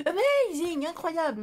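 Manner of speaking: high, loud mock advertising hype on Amazing; packaging hype. Echoing the hype words printed on craft packaging, mock ad voice. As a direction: sell it like the box does, half joking. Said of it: "comme ils disent sur les emballages".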